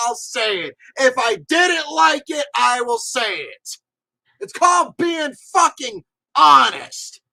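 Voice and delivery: loud, high-pitched, forceful declaration; emphatic insistence. Real, not acted. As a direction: lay down the law, punching each phrase. Emphatic vow to be blunt.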